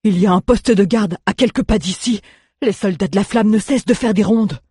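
a hint of a nervous local warning of patrols; anxious stranger. Stranger voiced by narrator.

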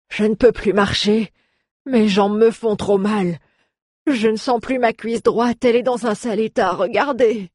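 high-pitched, pained dwarf voice; complaining dwarf. A narrator's reading. Injured dwarf telling his rescuer he can't walk, complaining. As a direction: complain high about the pain.

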